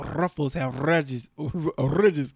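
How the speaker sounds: Ruffles and ridges with a long trilled r; rolled r. Retold or imitated. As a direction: roll the r of Ruffles and ridges, ad style. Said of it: "that Ruffles commercial".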